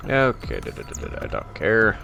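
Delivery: usual speaking voice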